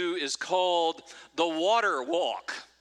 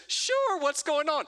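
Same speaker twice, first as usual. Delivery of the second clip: very high, squeaky mock Eric; Eric's eager reply; 10 semitones higher than usual. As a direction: play Eric chirping back eagerly. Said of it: "And Eric, of course, says".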